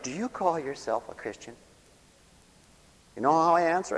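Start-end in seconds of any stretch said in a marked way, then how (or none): 0.0-1.5 quieter reproach. Acted. Somebody questions an upset believer, softer understated reproach. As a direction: softly put challenge. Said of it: "then somebody will say that".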